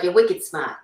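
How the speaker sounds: Boston "wicked smart", dropping the R; Boston accent. Shown as a demonstration. Giving an example of Boston talk, Boston accent. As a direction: drop the R's like a Bostonian. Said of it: "a Boston thing".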